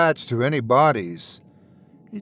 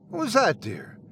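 lightly voiced wife; 7 semitones higher, 5 dB quieter than usual. Wife calls from bathroom.

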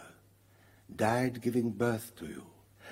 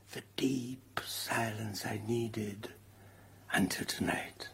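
whispering; 2 semitones lower, 6 dB quieter than usual. Acted. Ghost speaks softly to Mary.

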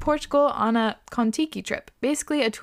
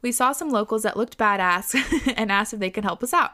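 ordinary delivery

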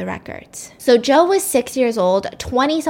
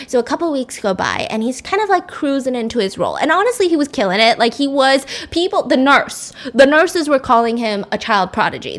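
normal talking voice

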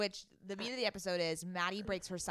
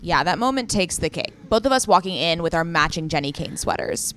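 loud, bright sponsor read; 2 semitones lower, 13 dB louder than usual. Acted. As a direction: sell it like a podcast ad. Reads sponsor ad.

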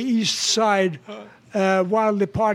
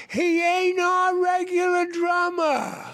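high, slow hollered reply; hollered punchline; 10 semitones higher, slower than usual. Retold or imitated. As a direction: holler it back across the river. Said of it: "an Indian hollered back".

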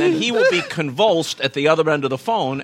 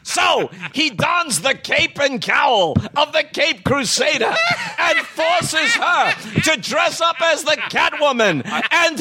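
booming announcer; 12 semitones higher than usual. Acted. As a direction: ham it up like a dramatic announcer. Dramatic superhero narration of joke.

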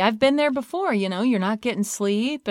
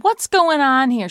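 louder, sharp challenge at the door; alarmed demand; 7 semitones higher, 9 dB louder, slower than usual. Retold or imitated. Mom bursting into daughter's room, loud and alarmed. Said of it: "I said".

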